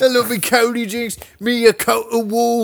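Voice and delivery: high-pitched British caricature; mock British accent. Bandmate riffing on [name], high hammed-up British accent. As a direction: play a squeaky cartoon Brit. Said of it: "starts in on the British accent".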